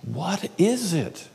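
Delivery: slow, whispered plea to God; whispered prayer of distress; pleading whisper. Retold or imitated. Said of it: "all day, I'm like".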